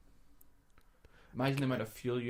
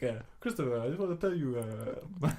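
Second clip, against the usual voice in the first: hammed-up South African impression, slightly raised; attempted South African accent. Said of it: "he's got, like, a weird accent".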